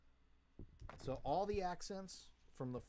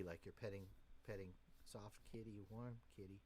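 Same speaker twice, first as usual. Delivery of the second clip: hushed, lower, gentle delivery; soft and pretty; 5 semitones lower, 10 dB quieter than usual. Shown as a demonstration. Bandleader coaxing a pretty sound from the band, soft and gentle. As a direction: speak softly, as if petting a kitten. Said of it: "Make it real pretty".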